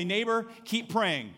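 slow, drawn-out neighbor call; slow, stressed congregation prompt; slow emphatic call. Shown as a demonstration. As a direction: say it slowly, leaning on neighbor. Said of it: "Turn to your neighbor right now and say".

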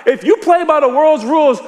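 high, rising preacher's fervor; full-force preaching. Acted. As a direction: proclaim it to the congregation, pitched high.

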